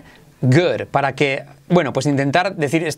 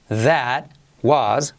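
"That... was", one word at a time; palabra por palabra. Shown as a demonstration. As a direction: separate "That" and "was" for repetition. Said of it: "Repite conmigo". (palabra por palabra, con pausas)

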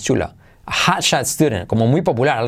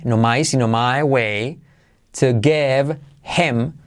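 usual speaking voice

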